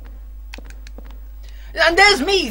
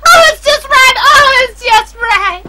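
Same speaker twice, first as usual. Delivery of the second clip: mock delight; 10 dB louder than usual. Mocking a fussy tea drinker.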